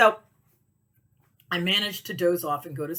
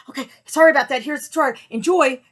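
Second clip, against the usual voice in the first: high, loud, over-bright customer reply; flustered cheery apology; 8 semitones higher, 10 dB louder than usual. Retold or imitated. Seller sending a customer the tour, flustered cheery apology. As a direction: cover the panic with forced cheer.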